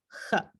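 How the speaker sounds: j as a soft velar rasp like in gente; j sound demo. Shown as a demonstration. Teacher comparing the letter to gente, quiet demo. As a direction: breathe out the j from the back of the mouth. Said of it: "Esta última como gente".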